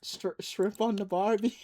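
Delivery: attempted Australian accent. Streamer doing a punishment for cursing, attempted Australian accent. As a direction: have a shaky go at an Australian accent. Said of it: "have to do an Australian accent".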